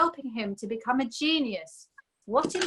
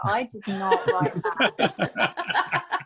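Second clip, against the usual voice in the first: laughing; 2 semitones lower, much slower than usual. Retold or imitated. Denying a shock message.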